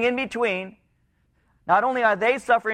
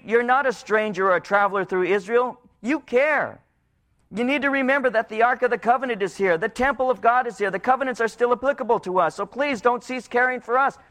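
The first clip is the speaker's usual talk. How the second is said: much higher, urgent cry to God; 5 semitones higher than usual. Retold or imitated. The people crying out to God, urgently imploring. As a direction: implore him to keep caring. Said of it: "they're crying out to him".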